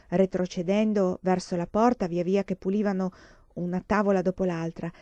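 ordinary delivery

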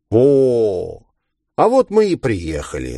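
lightly mocking villain; gloating villain. A narrator's reading. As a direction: announce the arrival with playful relish.

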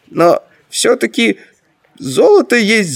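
high-voiced hesitation with pauses; waver, pitched well above normal; hesitant. A narrator's reading. Grand Master is tempted by the gold, hesitant. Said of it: "колеблясь, проговорил верховный магистр".

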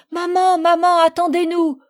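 high, loud call for mother; child crying out to be waited for; desperate cry. A narrator's reading. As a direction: shout after a departing boat. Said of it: "Je criai".

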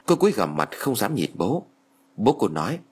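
ordinary delivery

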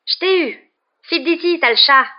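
high, loud scolding cry; heated high-pitched outburst; furious shouting. A narrator's reading. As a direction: yell it in angry triumph. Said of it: "les cris furieux qu'il lançait".

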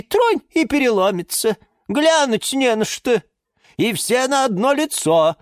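high-pitched catty character voice; disparaging, agreeing gossip. A narrator's reading. Plant Розалия Львовна runs down catalogue rivals, disparaging. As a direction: pick the rivals apart in a high voice. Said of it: "поддакнула Розалия Львовна".

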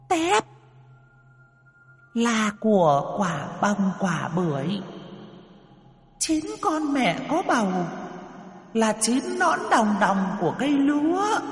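ghostly, angry high screech; eerie furious screech. A narrator's reading. Spirit reciting its terms to the cowering couple, furious high screech. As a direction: screech out each phrase in a very high demon voice. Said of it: "lại rít lên".

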